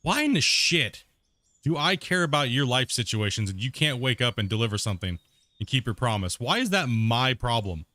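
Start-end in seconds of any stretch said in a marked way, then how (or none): 0.0-1.0 slow and weighty, every word stressed; emphatic slow question. Shown as a demonstration. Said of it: "the main question is this". 6.4-7.9 slow emphatic. Real, not acted. Slow emphatic rhetorical question.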